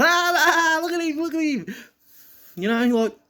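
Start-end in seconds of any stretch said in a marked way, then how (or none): 0.0-1.7 high, loud taunting cry; mocking jeer. Bigger kids jeering at a five-year-old, high mocking taunt. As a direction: imitate kids laughing and pointing, high and loud. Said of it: "everybody just laughs and points".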